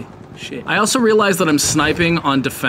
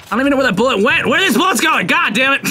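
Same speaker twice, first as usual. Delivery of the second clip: high, rapid exasperated complaint; fed up with vanishing bullets; high-pitched comic frustration; exasperated outburst; 9 semitones higher, faster than usual. Real, not acted. Exasperated at repeated missed shots.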